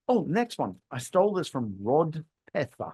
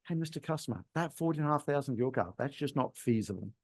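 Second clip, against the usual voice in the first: much softer, calm and gentle; soft, calm negotiation; 8 dB quieter than usual. Shown as a demonstration. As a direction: let the customer down gently and quietly. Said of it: "My two favorite words in negotiation".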